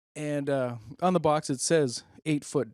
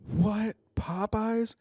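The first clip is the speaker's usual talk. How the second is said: mock surprise; 8 semitones higher, much slower than usual. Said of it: "We were like".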